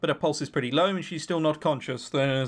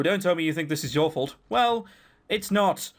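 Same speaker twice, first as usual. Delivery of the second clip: normal talking voice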